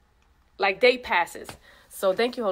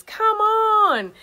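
excited cheer; 11 semitones higher, 6 dB louder than usual. Retold or imitated. Retreat guest cheering.

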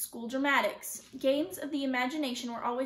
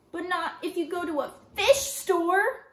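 put-on loud, high, drawn-out child's complaint; bellowing; 5 semitones higher, 6 dB louder than usual. A narrator's reading. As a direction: bellow it in indignant protest. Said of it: "Kirsty bellowed".

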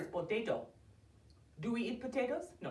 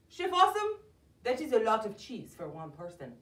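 ordinary delivery

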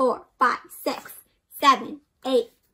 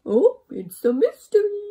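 high, louder coy innocence; sly fib; 8 semitones higher, 6 dB louder than usual. Acted. Dewey fibbing to Lovelock, high and coy. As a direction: play dumb about the berries. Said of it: "he says".

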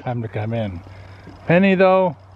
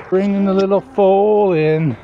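sing-song announcement; 5 semitones higher, 4 dB louder than usual. Real, not acted.

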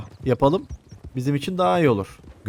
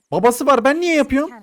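loud, very high-pitched protest; indignant outburst; 11 semitones higher, 5 dB louder than usual. Real, not acted. Gamer stuck with babysitting, indignant outburst. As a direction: complain at the top of the voice.

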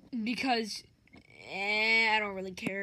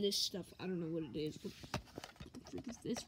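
whisper; 7 semitones lower, 14 dB quieter than usual. Acted. Puppet voice, examining strange toy.

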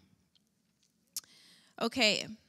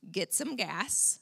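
ordinary talk

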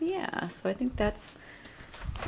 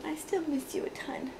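whispering; 7 semitones higher, 6 dB quieter than usual. Real, not acted. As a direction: whisper it tenderly. Whispered to baby.

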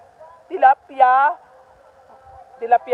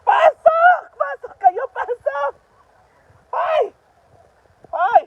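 shouting at ducks; 13 semitones higher, slower than usual. Real, not acted. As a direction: holler sharply to drive them in. Yelling ducks into the pen.

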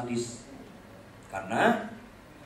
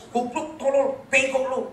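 father's insult; 11 semitones higher, 9 dB louder, slower than usual. Retold or imitated. Father insulting child, retold.

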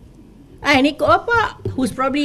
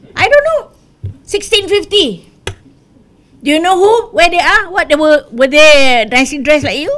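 heated rhetorical challenge; 3 semitones higher, 6 dB louder than usual. Real, not acted. Politician challenging race-baiters about their ancestors, loud and heated. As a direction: hammer the audience with each question.

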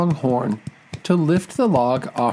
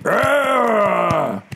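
long, high acted scream; high falling "aah" of a bitten buffalo; storybook yelp of pain, drawn out; 8 semitones higher, 3 dB louder than usual. A narrator's reading. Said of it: "The buffalo screamed in pain".

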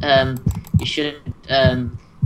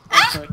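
very high, loud yelp; short piercing scream; startled shriek; 7 dB louder than usual. Real, not acted. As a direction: yelp sharply as if caught by surprise. Startled while mining.

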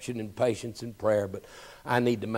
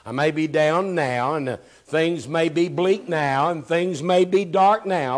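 higher, louder, slow building preach; slow, louder, climbing sermon cry; preaching at full force; 7 semitones higher, 8 dB louder than usual. Acted. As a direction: lift the congregation with rising intensity. Preaching climax.

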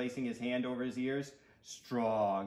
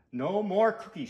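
higher, louder firm refusal; fed-up boy putting his foot down; shout; 6 semitones higher, 6 dB louder than usual. A narrator's reading. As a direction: shout it as an order. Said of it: "shouted Stuart".

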